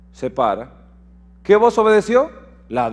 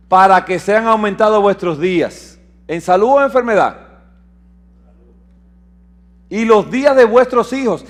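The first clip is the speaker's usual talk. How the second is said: proclaiming scripture; 3 semitones higher, 5 dB louder than usual. Acted. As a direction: lift the verse up to the hall. Reads the promise verse.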